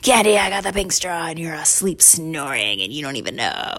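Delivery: lightly voiced boy's private taunt; boy gloating to himself. Boy talks to his sleeping sister from the pantry, mildly gloating. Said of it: "talking to himself in the pantry".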